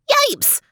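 sharp high startled yip. Acted. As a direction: yelp like a small dog. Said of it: "yipped Theo".

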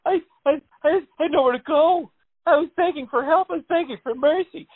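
high, tearful whimpering victim; mock-weeping dog at special victims unit; traumatized dog voice. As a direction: play the scared dog testifying to detectives. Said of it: "joins the dog voice".

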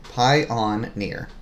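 word by word, spaced out evenly; deliberate clue reading. Shown as a demonstration. Reading a puzzle clue the partner must decode, slowly word by word. Repeats clue part for guesser.

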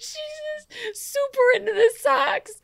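high, laughing squeal; laughing hard. Real, not acted. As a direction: talk through a fit of laughter. Laughing about Boston Emma.

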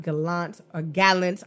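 "Gallant" stressed on the second syllable, then on the first; "Gallant" both ways, guh-LAHNT or GAL-unt; two pronunciations. Shown as a demonstration. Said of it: "what the pronunciation is".

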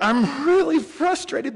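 high put-on voice of a frustrated mom, half crying; imitating mom. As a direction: squeak it out like mom laughing through tears.

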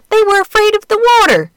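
mock alarm. Old duck recalling turkeys.

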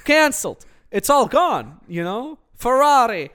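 loud, high hammed-up Italian accent; horrible Italian accent. Podcaster mocking Italy's lockdown in a bad Italian accent. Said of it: "I'm a horrible Italian accent".

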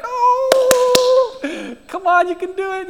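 put-on high, loud sing-song cheer to a toddler; cheering parent. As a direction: cheer the baby on like a delighted parent. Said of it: "and they're like".

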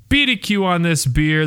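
ordinary talk

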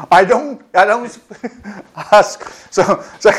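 words breaking into laughter; laughing. Real, not acted. Preacher cracking up while retelling Wigglesworth's reply, laughing through words.

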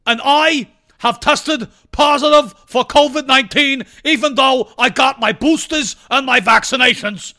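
put-on loud, high, slow old senator voice; hammed-up Bernie rant at full volume; Bernie Sanders impression. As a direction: shout it like a raspy old Vermont senator. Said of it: "Yes, that is my Bernie Sanders voice".

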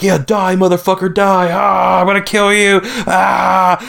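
high, strained hammed-up death threat; high-pitched frenzied mock rage; mock freak-out. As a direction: imitate someone losing it mid-attack, ending in cries. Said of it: "they're both freaking out".

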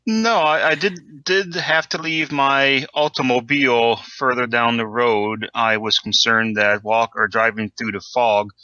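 ordinary delivery

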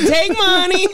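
high-pitched comic quote. Pretend. Host voicing a musician for laughs, high mock voice. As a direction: pitch it way up in a joke voice. Acting out [name] jokingly.

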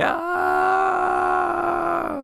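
high, long dragged-away scream. Acted. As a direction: shriek as she's pulled back into the dark. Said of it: "screaming all the way".

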